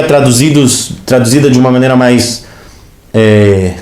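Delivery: ordinary delivery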